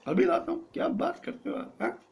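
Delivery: high cocky voice. Acted. Hero answering the director in a high cocky voice. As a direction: squeak back cockily at the director. Actor cockily agrees.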